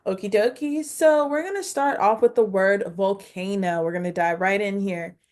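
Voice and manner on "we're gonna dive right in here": ordinary talk, nothing marked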